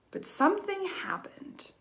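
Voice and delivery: dramatic whisper. Acted. To viewers, building suspense before the turn, whispered. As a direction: breathe it like a secret revealed.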